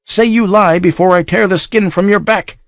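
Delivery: furious accusation. Acted. Maddened count threatens the child.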